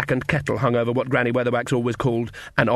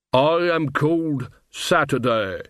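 slow, stretched zombie voice; 3 semitones higher, slower than usual. A narrator's reading. Zombie gives Nanny his name, slow and undead. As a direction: intone each word like the walking dead.

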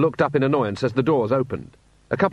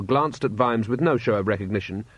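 ordinary delivery, nothing marked

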